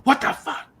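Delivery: very high, shocked outburst; freaked-out shriek. Retold or imitated. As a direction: imitate a friend freaking out, high-pitched. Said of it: "She, she freaked out".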